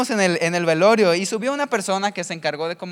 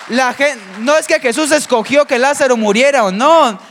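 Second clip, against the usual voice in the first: louder, higher, driving the point home; rising pulpit fervour, hammering each phrase; preaching at full force; 4 semitones higher, 6 dB louder than usual. Acted. Preacher urging the congregation about hope, forceful.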